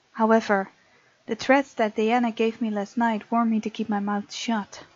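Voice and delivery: usual speaking voice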